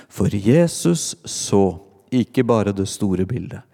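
ordinary delivery

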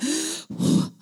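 sharp high intake of breath; panicked gasp. Retold or imitated. Stuck passenger bent over the pot, mock-panicked gasp. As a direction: gasp as if about to be sick.

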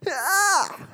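sharp, very high gasp-cry of shock; a child's horrified high yelp at the news. Acted. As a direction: let out a startled high cry. Reacting to God's threat.

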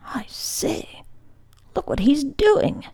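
hushed start rising to a high exclamation; exclaiming. A narrator's reading. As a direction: exclaim it under the breath, then pitch up in surprise. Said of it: "I heard Mahoney exclaim".

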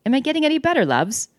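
hopeful, high-pitched fake British; bad British accent. A narrator's reading. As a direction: try hard at an English accent and miss. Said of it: "I sounded like [name]".

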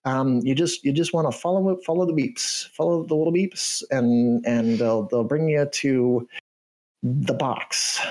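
canary voice. Acted. Canary NPC explains sniffer.

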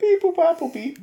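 high beeps fading off; toy robot voice. Acted. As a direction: toy robot chirps, trailing away. Random generator noises.

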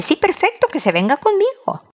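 high, lilting eager yes; agreeable dad. Acted. Dad agreeing to take his son, readily. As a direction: pitch jumps up in ready agreement.

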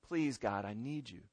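low, soft plea to God; hushed, fading prayer; humble, desperate plea. Acted. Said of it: "desperate cry from somebody who knows".

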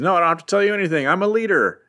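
high and very fast dismissal; hammed-up haughty brush-off; snippy villain leader. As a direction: rattle it off like an arrogant boss. Plunderer brushing off his men.

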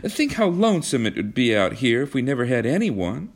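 put-on high-pitched, animated girl's voice; pleading young woman. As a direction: plead your case in a high voice.

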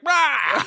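high, sudden "rah"; jump-scare roar. Retold or imitated. Crazy-eyed priest jumps him from behind, high mock roar. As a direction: pounce with a mock monster yell. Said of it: "jumps him from behind and is like".

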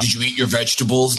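a hint of a gruff parent; deep voice. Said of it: "Like that".

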